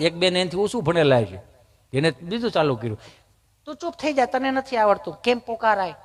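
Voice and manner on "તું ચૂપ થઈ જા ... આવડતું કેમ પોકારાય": high, soft mock scolding; bossy city visitor. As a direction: shush a friend in a prissy, superior tone. Second visitor scolds the first.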